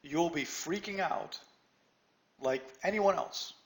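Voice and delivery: normal talking voice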